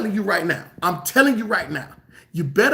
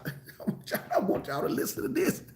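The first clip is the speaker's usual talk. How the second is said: breathy chuckle through the words, higher; laughing; 8 semitones higher, 10 dB quieter than usual. Real, not acted. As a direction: laugh it out, barely voiced. Laughs mid-sermon before reading.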